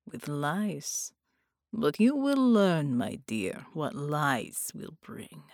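a dark teacher savouring each word; menacing seducer. Acted. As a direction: sorcerer instructs Carolina in a low hush.

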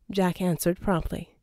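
usual speaking voice

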